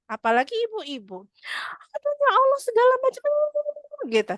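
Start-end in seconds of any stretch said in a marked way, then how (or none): 1.9-3.3 very high, loud rapid-fire fussing; hammed-up shrieky exasperated jabber; fast nagging. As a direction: imitate a mother rattling off complaints. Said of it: "ngomongnya tuh cepet banget".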